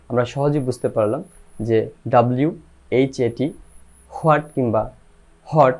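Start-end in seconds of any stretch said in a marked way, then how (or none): 4.2-5.8 হোয়াট as "ho-at", হট as "hot"; wrong pronunciation. Shown as a demonstration. Teacher recaps errors for learners, h-initial হোয়াট and হট. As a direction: sound the h at the start of হোয়াট and হট. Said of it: "এটার কোনোটাই সঠিক নয়".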